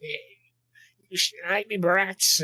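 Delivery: high, snarling creature taunt; kobold voice. Acted. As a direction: sneer it like a scrappy lizard goblin. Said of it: "broken common".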